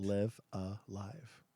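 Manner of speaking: "Live Alive" drawn out slowly and softly as "liv uh-LYVE"; slow demonstrated pronunciation. Shown as a demonstration. Co-host explaining the title, slow, quiet "Live Alive" as liv uh-LYVE. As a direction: spell out "Live Alive" by ear, short i then long i, low and slow. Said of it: "You live when you're alive".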